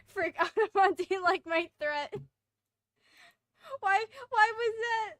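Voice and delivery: high, loud, laughing disbelief; real laughing fit. Real, not acted. Player asking why that happened, loud and laughing. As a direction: struggle to talk through laughter.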